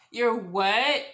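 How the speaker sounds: loud, rising shocked question; incredulous outcry. Retold or imitated. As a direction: exclaim it in open disbelief. Louder repeat of stunned reaction.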